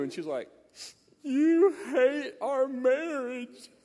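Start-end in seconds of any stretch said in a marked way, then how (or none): 1.2-3.7 high, drawn-out mock wail; hammed-up crying wife, slow and loud; tearful wife. Wife accuses husband over his note, mock tearful wail.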